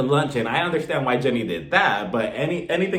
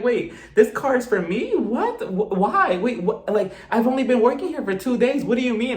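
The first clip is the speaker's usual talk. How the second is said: higher-pitched, overwhelmed mock reaction; imitating emotional disbelief; 8 semitones higher than usual. New employee reacting to a surprise gift car, mock emotional. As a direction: act out stunned, stammering disbelief up high. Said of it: "She starts getting emotional".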